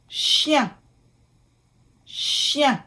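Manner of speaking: "Chien" in French, "shyen" with a nasal ending; careful French pronunciation. Shown as a demonstration. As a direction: model "Chien" with a nasal vowel. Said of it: "it will sound like un".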